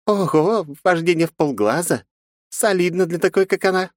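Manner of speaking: high amused sneer; smirking mockery. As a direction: smirk while you mock. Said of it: "усмехнулась глава синдиката". (с усмешкой)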